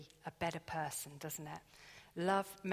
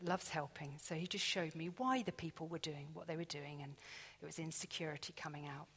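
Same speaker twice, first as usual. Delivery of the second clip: ordinary talk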